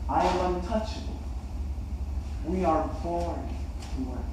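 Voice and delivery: soft, halting and subdued; slow reply. Acted. Cobbler explaining he is untouchable, slow and subdued. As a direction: answer quietly, with heavy pauses between phrases. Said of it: "he slowly replied".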